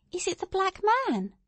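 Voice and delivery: put-on high little-girl question; curious, high-pitched girl; asking child. A narrator's reading. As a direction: ask in a small child's high voice. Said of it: "asked Pearl".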